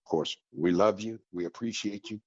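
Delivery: ordinary talk, nothing marked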